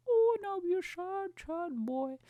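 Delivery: high, hammed-up little whine; badly faked boo-hoo voice; mock crying. Comedian imagining losing the money, high mock crying. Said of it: "I'll be crying".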